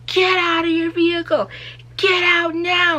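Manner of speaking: high, loud barked command, slowing at the end; angry yelled order. Retold or imitated. Police officer to driver, furious yelling order. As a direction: bark it like an enraged cop. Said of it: "he's yelling and he's pissed".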